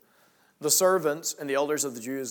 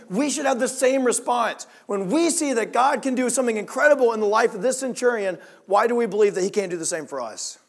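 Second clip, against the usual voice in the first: loud, rising sermon climax; an impassioned preacher urging the congregation to believe; preaching at full force; 3 semitones higher, 5 dB louder than usual. Acted. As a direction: drive the message home from the pulpit.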